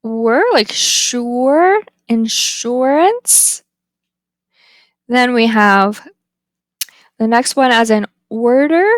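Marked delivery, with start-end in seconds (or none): 0.0-3.6 "were", "sure", "insurance" drawn out slowly to show the er vowel; slow, careful teacher demo of the er sound. Shown as a demonstration. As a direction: stretch the r-colored er in "sure" and "insurance". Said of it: "sounds like".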